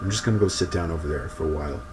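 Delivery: low, soft, quick mumble; quiet quick mumbling under the breath; mumbled. A narrator's reading. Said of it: "and he mumbled".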